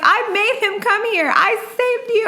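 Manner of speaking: high-pitched aggrieved protest; retold emotional outburst. Sister getting really emotional at dad, high aggrieved outburst. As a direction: plead your case, worked up. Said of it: "really emotional and I started crying".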